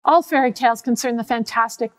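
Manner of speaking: usual speaking voice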